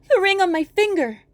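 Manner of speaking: put-on young girl's high wail; despairing maiden. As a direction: cry out your hopelessness. Maiden offers ring.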